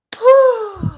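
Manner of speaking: a long, high emotional sigh; heavy sigh. Real, not acted. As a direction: let out a big, loud sigh. Still emotional over episode.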